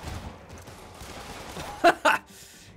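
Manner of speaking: high, breath-filled laugh; real laughing. Real, not acted. Player seeing someone trip on banana peel, laughing. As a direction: burst out laughing.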